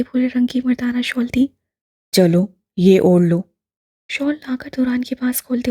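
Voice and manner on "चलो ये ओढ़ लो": slow, low, louder offer; lightly voiced old man, kind; gentle old man. A narrator's reading. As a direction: kindly urge him to take it.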